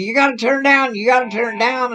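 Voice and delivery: high-pitched nagging repetition; mild mock yell. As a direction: imitate a soundman yelling over the band. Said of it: "the sound man kept screaming to me".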